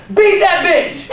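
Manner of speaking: high, loud yelled greeting; hammed-up stranger yelling; shout. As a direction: scream it in his face. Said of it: "she went".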